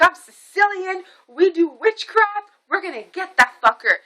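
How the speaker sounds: imitating a fierce Sicilian woman; retelling a Sicilian healer's vow of witchcraft, high and menacing. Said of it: "Sicilian woman vowing revenge".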